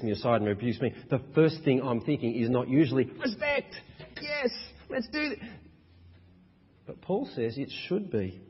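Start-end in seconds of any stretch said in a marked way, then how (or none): 3.0-5.4 much higher, sarcastic, hammed-up enthusiasm; mock eagerness. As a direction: play the overeager yes-man you never are. Mock eager thought when rebuked.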